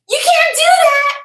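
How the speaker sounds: loud, high imitation of a screaming neighbour; retold screaming. Neighbour objects to the dog walkers, screaming high. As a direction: yell it way up high, mimicking her. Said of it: "she starts screaming at me".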